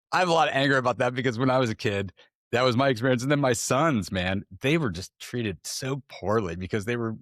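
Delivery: ordinary talk, nothing marked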